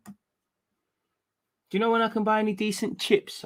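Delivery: drawn-out fancy English accent, acting up; slow posh English accent asking for chips; mock posh English accent. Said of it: "Do you know how much acting I'll be".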